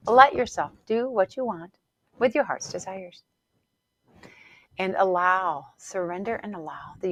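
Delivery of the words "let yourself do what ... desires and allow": ordinary delivery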